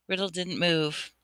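plain reading voice

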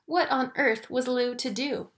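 usual speaking voice

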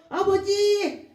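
loud, drawn-out plea to God; high, imploring prayer cry. Shown as a demonstration. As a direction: call out slowly for rescue. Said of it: "이렇게 기도하게 되는 것입니다".

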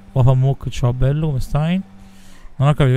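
ordinary delivery

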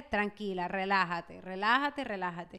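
tranquilizador. Retold or imitated. Voices husband calming her down. (voz baja que calma)

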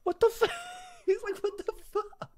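very high squeak through laughter; laughing disbelief. Real, not acted. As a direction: squeal it, cracking up.

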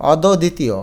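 অদ্বিতীয় as "aw-do-ditiyo"; without hasanta. Shown as a demonstration. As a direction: add an o after the first d of অদ্বিতীয়. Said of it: "যদি হসন্ত না দেই". (অদ্বিতীয়, হসন্ত ছাড়া অদোদিতীয়)

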